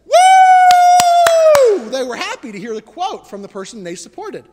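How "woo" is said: much higher, much louder whooping cheer; a loud high crowd woo. Retold or imitated. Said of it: "and they said".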